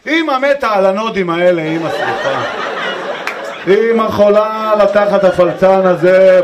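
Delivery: loud, slow doting mother voice; wife cooing at baby. Retold or imitated. Wife gushing loudly over the farting baby, doting voice. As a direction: gush over the baby like a besotted mom.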